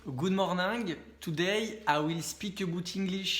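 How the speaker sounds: English spoken slow with a thick French accent; heavy French accent. Shown as a demonstration. YouTuber greets viewers in English, demoing a thick French accent. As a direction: say it like a typical Frenchy speaker of English. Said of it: "comme je viens de parler ici".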